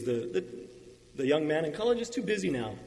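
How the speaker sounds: usual speaking voice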